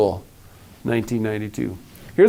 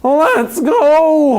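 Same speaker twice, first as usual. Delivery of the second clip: high, louder honk; honking goose; 7 semitones higher, 4 dB louder than usual. A narrator's reading. Goose agreeing to go, honking. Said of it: "honked Goosey Lucy".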